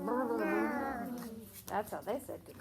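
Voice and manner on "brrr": a shivery lip-trill brrr; shivering brrr. Shown as a demonstration. Teacher shows a child a cold snowman's brrr. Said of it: "Can you shake and like you're cold?".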